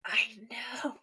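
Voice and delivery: breathy whisper. Real, not acted. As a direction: whisper it in agreement. Whispered agreement.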